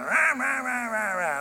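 high, loud wordless griping; mock grumbling. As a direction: act out a grumbler's rah-rah noises. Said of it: "grumbling is what I started doing".

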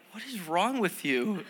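mock hurt kid. Kids to their prank-playing mom, mock hurt. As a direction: imitate a kid lightly wounded by mom. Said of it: "man, they're so hurt".

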